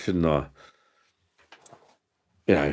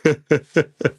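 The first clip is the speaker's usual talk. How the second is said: loud high laugh; 8 semitones higher, 5 dB louder than usual. Real, not acted. Big laugh.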